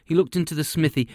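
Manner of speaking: ordinary delivery, nothing marked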